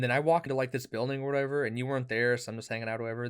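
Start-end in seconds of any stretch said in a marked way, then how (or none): none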